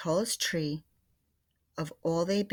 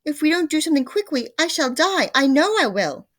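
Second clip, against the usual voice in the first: very high, loud, fast panic; frantic; 10 semitones higher, 10 dB louder than usual. A narrator's reading. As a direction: rush it out in terror. Mrs Twit panicking.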